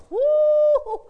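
high, loud joyful whoop; sudden whoop of praise; excited whoop. Real, not acted. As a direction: let out a happy holler.

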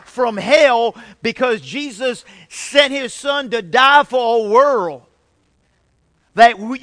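loud, emphatic preaching. Real, not acted. Pastor rallies church to rescue souls from hell, forceful preaching.

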